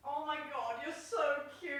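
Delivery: high gushing coo at the cat; gushing pet talk. Real, not acted. Owner gushes at her cat Pochi, high coo. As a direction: melt over the kitten.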